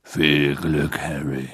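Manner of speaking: low hushed good-luck; Hagrid voice. A narrator's reading. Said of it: "flüsterte Hagrid".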